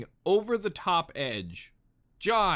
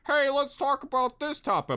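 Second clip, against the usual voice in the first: higher, lively mock viewer voice; 10 semitones higher than usual. Mocking fans who ask about the topic. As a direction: imitate viewers asking eagerly. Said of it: "you guys were like".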